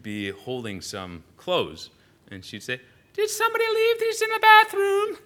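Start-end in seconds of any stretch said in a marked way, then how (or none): 3.1-5.2 very high, loud hammed-up English widow; English accent pitched way up, slightly absurd. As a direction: call it out in a piercing mock English accent. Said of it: "there's my English accent that comes out".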